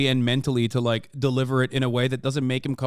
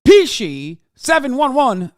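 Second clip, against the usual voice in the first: drawn out, high and loud; excited shout-out; 10 semitones higher, 9 dB louder, slower than usual. Real, not acted. Streamer cheering a donor's name, excited. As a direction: call the donor's name out with delight. Thanking a gifted sub donor.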